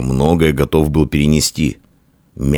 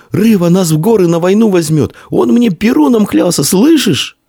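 put-on very high, thrilled boy's voice; high-pitched excited cry; breathless shouting; 4 dB louder than usual. A narrator's reading. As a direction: blurt the big news, pitched way up. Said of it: "задыхаясь, кричал он".

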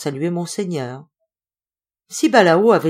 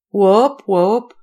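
cri de singe; 3 semitones higher, 6 dB louder than usual. Acted.

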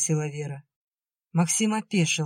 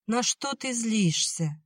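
high, slow, careful question; searching question; 6 semitones higher, slower than usual. A narrator's reading. Nadya asking Vera about her anger, careful. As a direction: probe gently, drawing the words out. Hesitant question to angry sister.

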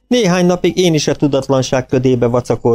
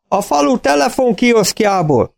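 farmer voice; 13 semitones higher than usual. A narrator's reading. Farmer on phone.

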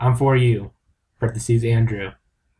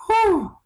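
mock whew. Mock heat.

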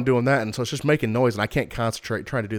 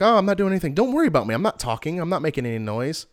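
high, sing-song imitation of mom; high mock-innocent mom voice; mom's mock innocence; 6 semitones higher than usual. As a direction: mimic mom's innocent protest, pitched up. Mom voiced in retold scene.

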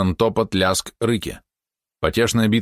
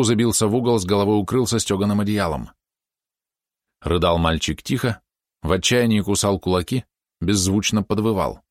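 ordinary delivery

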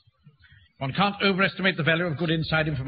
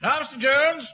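raised, higher official voice; officious announcement; 7 semitones higher than usual. Acted. Captain to old man behind the door, officious announcement. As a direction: announce yourself with full authority.

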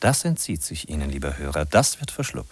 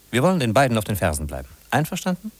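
ordinary delivery

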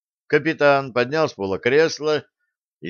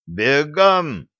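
loud, higher-pitched command; sharp raised-voice bark; barked order; 6 semitones higher, 5 dB louder than usual. A narrator's reading. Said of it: "рявкнул Пит".

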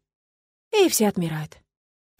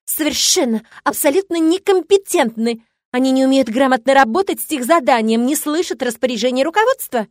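loud, higher angry rant; 4 semitones higher, 5 dB louder than usual. A narrator's reading. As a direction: rail at them furiously. Victoria's angry tirade.

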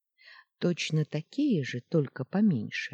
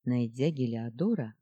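plain reading voice

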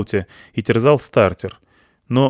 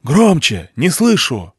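shouting over noise; 6 semitones higher, 4 dB louder, slower than usual. A narrator's reading.

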